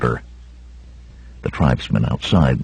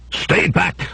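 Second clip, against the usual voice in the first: crying out; 11 semitones higher, 4 dB quieter than usual. A narrator's reading. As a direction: cry out a warning in a raised pitch. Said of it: "the red-robed man cried".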